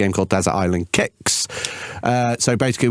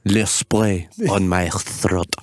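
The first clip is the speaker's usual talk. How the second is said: slow, hammed-up French advert voice; a lightly done French accent, very slow; mock French accent; 4 semitones lower, much slower than usual. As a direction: drawl it in a cheesy French accent. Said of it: "They're basically French".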